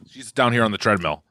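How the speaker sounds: ordinary delivery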